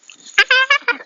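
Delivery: bursts into a high giggle; high laugh. Real, not acted. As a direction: laugh at own mistake.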